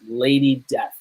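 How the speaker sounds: ordinary talk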